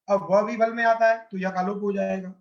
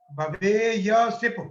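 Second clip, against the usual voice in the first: drawn-out, syllable by syllable; slow spelled-out form; much slower than usual. Shown as a demonstration. Teacher dictating a verb form to students, slowly drawn out. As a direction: stretch each sound of भवेय सिप slowly for students. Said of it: "इसके बाद मध्यम पुरुष एक वचन में".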